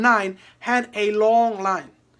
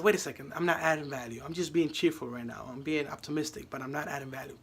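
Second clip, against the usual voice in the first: quiet inner thought; 7 semitones lower, 10 dB quieter than usual. Retold or imitated. Tony thinking to himself.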